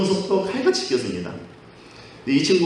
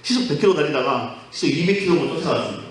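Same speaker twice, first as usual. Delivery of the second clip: ordinary talk, nothing marked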